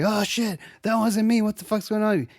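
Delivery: high, startled and confused; startled panic. Retold or imitated. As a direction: snap out of a trance, alarmed. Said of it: "she snaps out of it".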